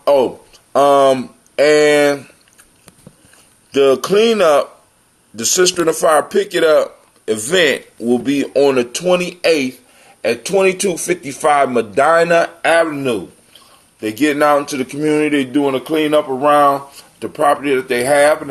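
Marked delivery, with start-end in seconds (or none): none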